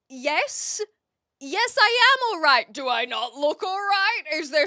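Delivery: loud, high, mock-offended yes; exasperated loud reply. Answering a Brit's greeting, exasperated and loud. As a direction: insist loudly that you are fine.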